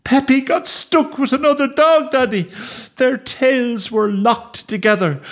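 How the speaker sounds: high, wailing, gasping explanation; hysterical child. Acted. Boy explaining the accident to his daddy, hysterical wailing. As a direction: pour it out high and distraught.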